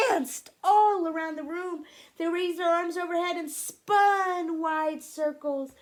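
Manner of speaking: high, loud, swinging storytelling; exuberant narration. A narrator's reading. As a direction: sweep the listener into the dance. Narrating a wild dance.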